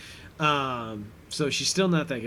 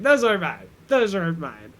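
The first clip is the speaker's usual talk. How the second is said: higher, louder hasty denial; mock defensive protest; 4 semitones higher, 5 dB louder, faster than usual. Brother disowning spiky necklaces, mock defensive.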